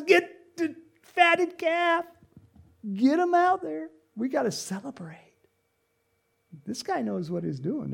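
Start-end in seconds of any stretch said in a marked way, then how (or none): 0.0-5.2 high, loud, stretched-out celebratory call; jubilant calling out. Acted. Father orders a feast for his son, jubilant. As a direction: shout orders for a party.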